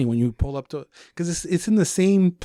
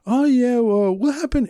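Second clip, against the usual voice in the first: high playing-dumb voice, higher and louder; innocent act; 10 semitones higher, 3 dB louder than usual. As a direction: feign innocence to the cops, pitched up. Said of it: "I was like".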